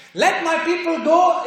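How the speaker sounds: a decree shouted out; commanding voice. Shown as a demonstration. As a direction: declare it with authority. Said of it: "is a commanding voice".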